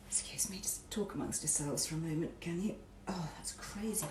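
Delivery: whispering while struggling; hushed aside to viewers; whispered aside. Real, not acted. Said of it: "I can't open the jar".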